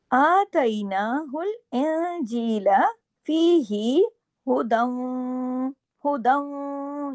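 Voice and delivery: slow melodic tajweed chant; slow Quranic recitation with held vowels. Shown as a demonstration. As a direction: recite the verse slowly, stretching each vowel. Recites verse slowly.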